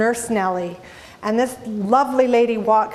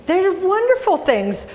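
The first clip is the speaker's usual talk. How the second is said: normal talking voice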